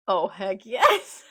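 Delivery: loud, slow, relishing cheer ending in a laugh; thrilled. Real, not acted. Fan delighted at Whitebeard's rebuke, thrilled. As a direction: cheer it with rising glee.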